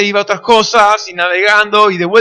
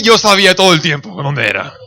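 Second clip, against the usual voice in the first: lower, laughing cover-up; a dad pretending he knew all along; sheepish father; 6 semitones lower than usual. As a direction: save face with a chuckle. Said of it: "Mi papá dice".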